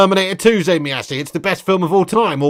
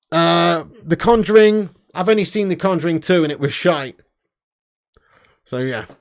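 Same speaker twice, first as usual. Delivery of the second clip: ordinary talk, nothing marked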